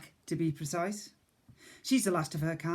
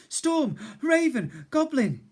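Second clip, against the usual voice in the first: slow, loud, high word by word; breathless blurt; 10 semitones higher, 6 dB louder, slower than usual. Acted. Boy gasps the news to the cook, loud. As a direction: spit out each word between breaths.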